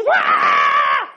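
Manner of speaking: loud mock bawling wail; big, noisy fake cry; ugly crying. Shown as a demonstration. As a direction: burst out in a hammed-up ugly sob. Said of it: "it's ugly crying".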